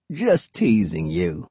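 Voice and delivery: slow, low, soft drawl; teasing, hushed hermit voice; murmuring hermit. A narrator's reading. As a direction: draw it out softly and low.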